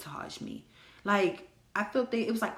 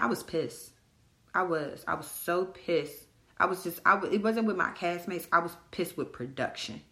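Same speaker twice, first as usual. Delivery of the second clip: usual speaking voice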